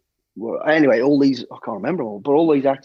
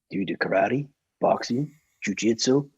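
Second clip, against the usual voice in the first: low film-star impression, quietly questioning; Clint Eastwood; 4 semitones lower, 3 dB quieter than usual. Film star quizzing a bodyguard on fighting skills, low impression. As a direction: quiz him in a low American movie-legend voice. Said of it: "and in, in that accent".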